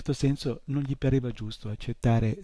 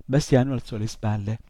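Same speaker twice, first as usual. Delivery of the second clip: plain reading voice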